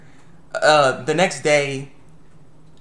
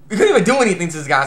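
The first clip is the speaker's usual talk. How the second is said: ordinary talk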